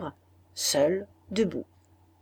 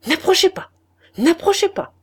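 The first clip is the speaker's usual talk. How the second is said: loud, high cry of fear; a loud, high, terrified no; frightened cry; 13 semitones higher, 9 dB louder than usual. A narrator's reading. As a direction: recoil and cry out. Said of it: "me suis-je écriée ... avec un mouvement d'effroi".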